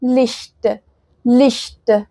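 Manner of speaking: "لشت" slowly as Licht with airy palatal ch; German ich sound. Shown as a demonstration. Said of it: "زي كلمة لشت".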